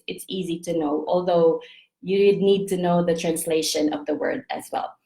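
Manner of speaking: ordinary talk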